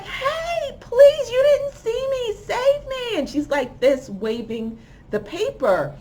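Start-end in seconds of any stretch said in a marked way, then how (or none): 0.0-3.2 very high-pitched, mock-frantic cry for rescue; desperate plea. Woman in a car begs a hidden stranger for rescue, desperate high plea. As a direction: imitate a woman crying out to be saved.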